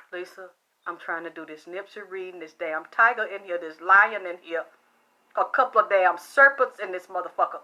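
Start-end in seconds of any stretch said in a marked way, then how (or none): none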